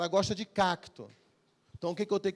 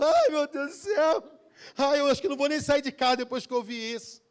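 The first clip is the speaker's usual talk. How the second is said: hammed-up tearful wail, higher and louder; mock weeping; 8 semitones higher, 6 dB louder than usual. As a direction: imitate a believer crying along. Crente chorando com história triste. (lamento choroso exagerado)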